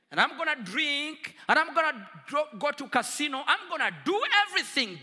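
loud, raging declaration; shouting defiance, building; defiant angry vow. Retold or imitated. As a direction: hurl the vow at God.

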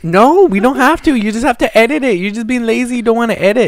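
high, loud, insistent protest; exasperated scolding. Real, not acted. Host scolds tired co-host over restarting, exasperated. Argues against restarting the podcast.

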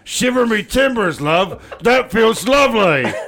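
high, hammed-up pirate voice; slow, relishing pirate cry; pirate lover. Pirate to lover in a fan fiction, hammed-up and high. Voicing pirate in erotic story.

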